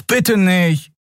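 high, beseeching little plea; pleading. A narrator's reading. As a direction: beg them not to. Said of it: "flehte Miko".

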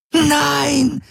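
very high shrieking Nein; screamed no. Acted. Prisoner dragged to capsule screaming, very high. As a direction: shriek it in terror. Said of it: "schrie Ylana unaufhörlich".

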